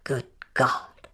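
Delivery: whispered under the breath in shock; a stunned whisper to herself; breathless whispered oath. Acted. Shocked inner exclamation.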